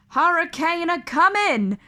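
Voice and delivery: high, loud sailor's warning; rough sailor yelling a warning; shouted. Acted. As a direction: bellow it over the storm. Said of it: "a sailor shouted".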